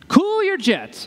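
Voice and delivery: high, quick playful jab; playful cool your jets; teasing. Real, not acted. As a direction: tease them to calm down. Calming the imagined crowd.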